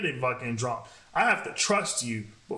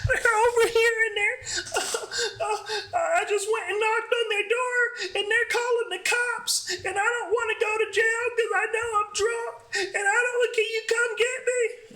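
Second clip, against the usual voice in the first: imitating her, high, half-laughing half-crying; mock the drunk friend panicking on the phone.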